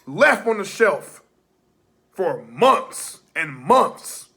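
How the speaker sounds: loud heated promo shout; wrestling promo. Acted. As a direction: build it up like a ring promo.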